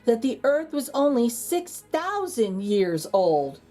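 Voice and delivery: lively storybook narration with dramatic pauses; animated read-aloud for kids; reading to children. A narrator's reading. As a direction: read it like a bedtime picture book. Reads picture book aloud.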